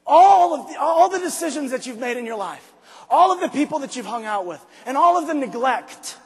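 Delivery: loud, high, raw self-reproach; shouting at himself. Retold or imitated. Man bawling at himself in the mirror, shouting. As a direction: yell the truth at your own reflection. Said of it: "I said".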